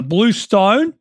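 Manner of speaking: slow, drawn-out Australian vowels; amateur Australian accent demo. Shown as a demonstration. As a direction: stretch it in an imitated Aussie accent. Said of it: "that's how they would say that".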